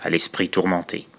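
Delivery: measured, subdued reading with pauses between verses; dark poem recitation. Acted. Horror storyteller reciting a poem about dark origins to listeners, sombre recitation. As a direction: recite it as a sombre first-person horror confession.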